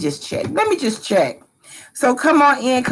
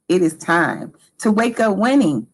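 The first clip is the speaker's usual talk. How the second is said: ordinary talk, nothing marked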